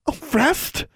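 high, gasped question; shocked gasp. A narrator's reading. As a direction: gasp the word in alarm, very high. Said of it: "Anna gasped".

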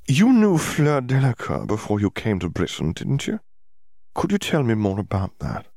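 lightly voiced nosy reporter; sly reporter voice. A narrator's reading. Skeeter voiced in story.

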